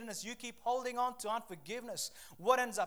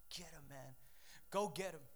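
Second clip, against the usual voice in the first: low, hushed order to attack; sly quiet command; 5 semitones lower, 7 dB quieter than usual. Retold or imitated. Devil to his dogs after a trespasser, quiet command. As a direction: imitate the devil quietly setting dogs on someone.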